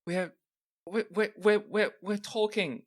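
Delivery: high, stuttering fright; scared stammer. Acted. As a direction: trip over the words in high fear. Cultist stammers under interrogation.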